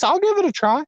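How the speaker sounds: mock eager. Angler mocking himself trying a stranger's tip, in a high put-on voice. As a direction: squeak it out like a gullible angler.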